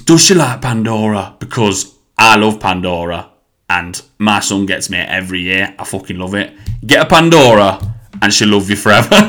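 emphatic, hammed-up Nottingham sales pitch; Nottingham hairdresser. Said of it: "Just, like, legit said that".

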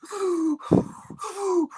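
high, far panicked gasp for air; hammed-up gasp; mock gasp. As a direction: gasp like you can't breathe in the heat. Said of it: "I'm like".

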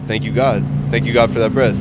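loud, grateful praise; loud thanks. Retold or imitated. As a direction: call out thanks to God loudly. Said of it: "he was like".

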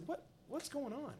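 high, slow confused question; confused shopper asking the angry man; bewildered. Retold or imitated. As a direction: ask genuinely baffled.

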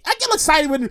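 normal talking voice

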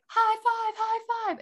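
high squeaky hype voice; excited high-pitched call. Retold or imitated. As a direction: cheer it out way up high. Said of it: "put out their hands and were like".

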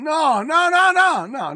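escalating loud no, no, no; emphatic loud no. Real, not acted. Correcting his own claim about the rain, loud and emphatic. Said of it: "correcting himself about the rain".

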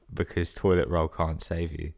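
ordinary talk, nothing marked

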